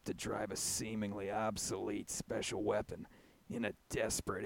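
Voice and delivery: low, very soft trailer narration; hushed, low movie-synopsis voice, trailing into a whisper; Charlie Hunnam impression. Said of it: "I'm gonna try to read this in, uh, what's-his-face's voice".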